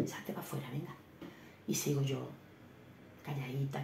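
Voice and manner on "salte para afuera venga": whisper. Real, not acted. Whispering to sister in shop.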